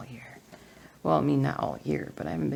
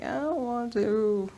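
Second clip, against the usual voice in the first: reluctant whine; 7 semitones higher, slower than usual. Retold or imitated. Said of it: "And I'm like".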